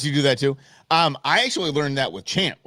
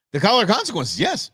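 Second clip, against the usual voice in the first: gleeful; 9 semitones higher than usual. Real, not acted. Gleeful callback.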